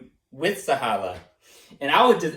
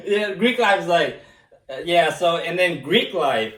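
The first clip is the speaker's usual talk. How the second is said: ordinary talk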